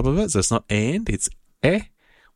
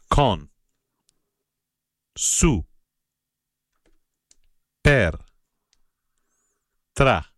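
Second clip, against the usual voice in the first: drill list; slower than usual. Shown as a demonstration. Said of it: "let's go through all our prepositions".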